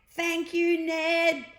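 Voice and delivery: high, loud happy call from the sky; children crying out. Acted. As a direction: call up thanks to the dragon riders. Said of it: "they cried".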